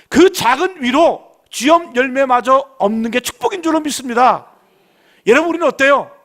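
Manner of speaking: loud, slow, drawn-out sermon proclamation; slow full-force preaching. Acted. Pastor proclaiming blessing to the congregation, slow loud preaching. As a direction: hammer home each word, slow and loud.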